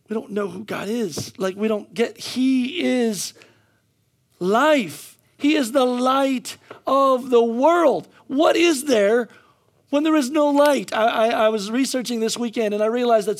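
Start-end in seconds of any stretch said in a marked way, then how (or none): 2.2-10.9 loud, high, slow proclamation; emphatic preaching. Real, not acted. Preacher proclaiming he is the light, emphatic. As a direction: land each phrase with weighty pauses.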